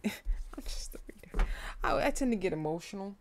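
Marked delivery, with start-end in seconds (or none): 0.0-1.5 slow, whispered laugh to self. Real, not acted. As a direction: whisper it slowly through a laugh. Laughing aside, repeats it under breath.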